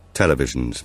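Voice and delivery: plain reading voice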